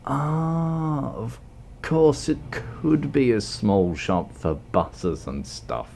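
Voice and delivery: slow, drawn-out mock realization, trailing down; put-on slow, deliberate delivery. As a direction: stretch out a high "Ah" then repeat it slowly. Slow put-on repeat of his guess.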